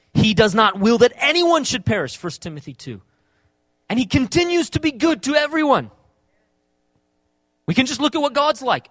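loud, emphatic sermon delivery; forceful preaching. Acted. Preacher to congregation on God loving everyone, forceful preaching.

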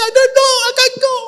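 frightened plea. Retold or imitated. Frightened reply to God.

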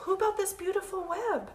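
high-voiced owl character; high, hooty question; storybook owl. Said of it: "asked the owl".

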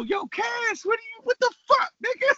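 high, fast, frantic calling out; squeaky mock yell, words tumbling out; retold yelling. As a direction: act out hollering out a window to a friend. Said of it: "I'm yelling out the window".

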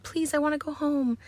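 high, whimpering plea; pleading girl. Retold or imitated. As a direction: beg to go home, pitched high. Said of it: "she's just like".

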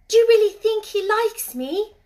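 high, loud, hopeful question; excited, pitch held high; eager cry. A narrator's reading. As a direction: ask it eagerly, like a girl longing to know. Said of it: "cried Mary eagerly".

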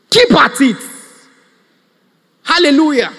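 loud, fired-up exhortation; preaching shout. Real, not acted. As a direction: belt it to the congregation.

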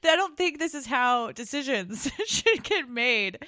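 high, loud, slowed words broken up by laughter; laughing. Real, not acted. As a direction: laugh through the words, drawing them out. Laughing at absurd plot logic.

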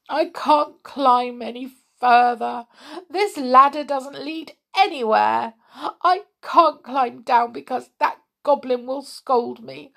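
put-on high, wailing girl's voice ending in boo-hoos; miserable. A narrator's reading. Connie stuck on the ladder, miserable. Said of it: "he heard a miserable voice above him".

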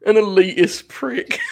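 real laughing disbelief; amused echo. Real, not acted. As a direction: repeat it slowly with delight, cracking up. Amused echo of co-host's phrase.

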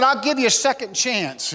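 high-pitched acted royal ultimatum; Nebuchadnezzar angry. Angry king offering the men one last chance, acted anger. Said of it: "He said".

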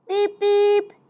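high, loud "beep beep"; two punchy held beeps; car horn beeps. Acted. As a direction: imitate a tooting horn.

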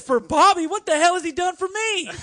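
loud, high-pitched incredulous outburst; retold outraged yell; indignant protest. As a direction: protest as if the favor is absurd. Retelling indignant protest to friend.

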